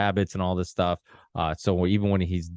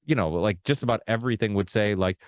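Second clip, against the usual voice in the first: normal talking voice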